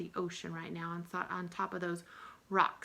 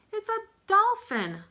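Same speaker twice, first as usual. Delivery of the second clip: higher, loud, thrilled announcement; loud, high excited reveal; 12 semitones higher, 10 dB louder than usual. Acted. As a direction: burst out with the answer. Teacher models guessing, then the reveal.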